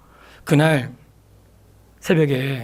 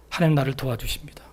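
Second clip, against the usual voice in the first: softly whispered confession of trust; whispered prayer; 4 semitones lower, 5 dB quieter than usual. Retold or imitated. Preacher confessing to God at dawn, whispered. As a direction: breathe it as an intimate prayer.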